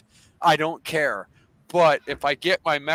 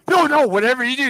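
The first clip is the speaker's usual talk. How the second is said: fast, loud, high-pitched pleading; urgent mock plea; 8 semitones higher, 7 dB louder, faster than usual. Begging friend not to call the man, panicky. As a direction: blurt it out as a retold panicky plea.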